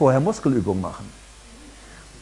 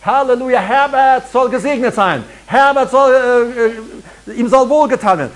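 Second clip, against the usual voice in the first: loud, high, joyful proclamation; hammed-up jubilant call; calling out praise; 6 semitones higher, 4 dB louder than usual. Shown as a demonstration. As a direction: shout the blessing over the name.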